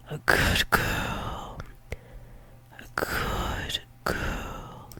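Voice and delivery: very soft, slow whisper; praising whisper. Acted. Praising a partner intimately.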